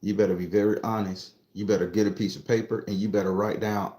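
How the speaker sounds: ordinary talk, nothing marked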